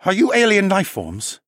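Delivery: high-pitched, incredulous questioning; astonished man with a French tinge; stunned engineer. Acted. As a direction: ask in disbelief, voice pitched right up.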